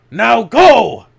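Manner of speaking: loud, high, hammer-blow command; commanding shout. A narrator's reading. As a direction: bark the order to flee. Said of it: "voice struck the Toa like a hammer blow".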